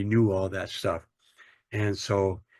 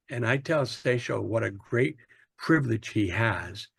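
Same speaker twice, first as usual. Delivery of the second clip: ordinary delivery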